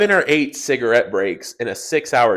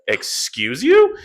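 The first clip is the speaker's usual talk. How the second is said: slow, louder "Excuse you?" with a rising end; indignant retort; 7 semitones higher, 6 dB louder, much slower than usual. Retold or imitated. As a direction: call him out, offended and drawn out. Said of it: "I was like".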